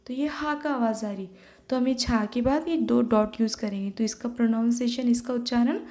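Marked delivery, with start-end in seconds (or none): none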